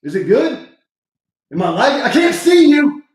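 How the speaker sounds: high, loud shout, slowing at the end; wife ignoring him, loud call. Real, not acted. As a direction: yell it to someone in the next room. Yelling to wife for answer.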